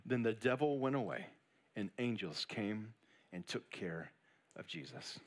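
hushed scripture reading before the sermon. Acted. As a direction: read the verse quietly and reverently.

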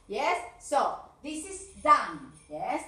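ordinary talk, nothing marked

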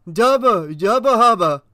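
loud, high, dumbstruck gibberish; stupefied babble. A narrator's reading. Officer trying to answer the burning man, stupefied. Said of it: "Martell informed him stupidly".

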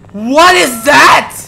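slow, loud alarmed question. Real, not acted. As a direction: demand it in sudden fright. Hears a noise in the dark.

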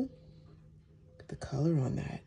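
hushed whisper, admiring. Real, not acted. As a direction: breathe it out as a whisper. Admiring the freshly cut soap.

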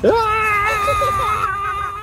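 high hammed-up crying shriek; mock scream. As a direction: wail as the ride jolts. Pretend wild ride.

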